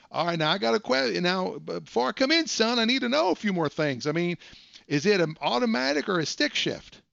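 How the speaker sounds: high, folksy put-on voice calling him son; old-timer caller. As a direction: play a fussy old buyer, pitched high. Prank caller calls him son.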